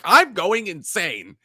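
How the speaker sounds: high, mock-desperate complaint; retold exasperation. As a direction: protest as if losing your mind.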